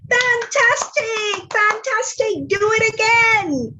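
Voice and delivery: a princess flattering the dragon in a soaring voice; gushing praise. Acted. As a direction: overdo the wonder to goad him.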